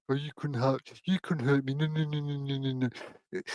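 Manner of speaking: soft, cutesy voice to a pet; small, babbling pet-talk murmur; teasing baby talk. Acted. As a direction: coo a playful dismissal, quietly. Teasing reply to threatening cat.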